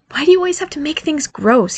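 high, exasperated whine; exasperated, high-pitched reproach; deploring complaint. Acted. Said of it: "he deplored Nick".

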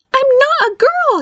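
high, slow, squeaky protest; indignant declaration. A narrator's reading. Barry insists to his mother he's no girl, indignant. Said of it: "he declared".

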